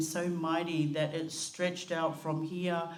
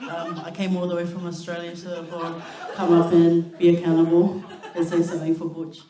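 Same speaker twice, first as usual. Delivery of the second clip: laughing nervously; 11 dB louder than usual. Real, not acted. Nervous laughing start of speech.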